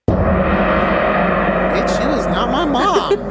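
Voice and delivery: loud, high, hammed-up outrage; indignant rant. Man fuming about bossy older coworker, hammed-up anger. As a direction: explode in comic indignation.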